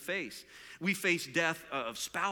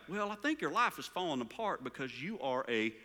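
put-on southern accent; 3 semitones lower than usual. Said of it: "with his southern accent".

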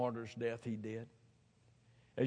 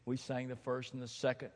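usual speaking voice

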